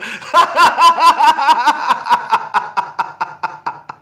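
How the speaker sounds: big high burst of laughter; loud laugh. Real, not acted. Friends burst out laughing at the breakup joke, loud high laugh. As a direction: crack up hard.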